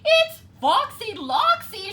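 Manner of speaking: very high, loud shriek. A narrator's reading. Chicken Little shrieking as she recognizes the fox. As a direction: shriek the name in alarm. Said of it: "she shrieked".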